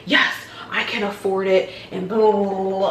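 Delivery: mock boast tailing into blahs; mock cocky. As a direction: pretend bragging, lightly hammed-up. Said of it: "I'm not a cocky person. I'm not gonna be like".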